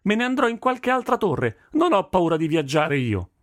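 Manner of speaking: very high-pitched, heated defiance; defiant daughter. A narrator's reading. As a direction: throw out a threat to leave. Daughter defies father in quarrel. (sfida accesa, voce acuta)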